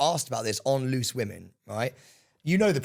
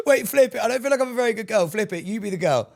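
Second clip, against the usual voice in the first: excited; 10 semitones higher, 5 dB louder than usual. Real, not acted. Excited louder high burst.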